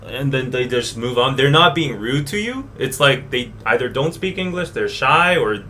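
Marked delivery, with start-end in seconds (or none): none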